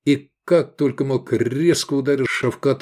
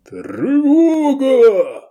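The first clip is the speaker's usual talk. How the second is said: long, high, loud bellow; roared alarm; 7 dB louder, much slower than usual. A narrator's reading. Commander raises the alarm, drawn-out roar. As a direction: roar it out, stretching the vowel. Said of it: "заревел знакомый голос".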